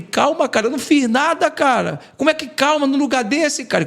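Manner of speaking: mildly retold sobbing outcry; half-performed anguished plea; desperate crying protest. Crying protest to cellmate in prison.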